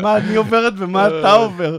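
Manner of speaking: exasperated wife. Retold or imitated. Exhausted wife complaining by phone.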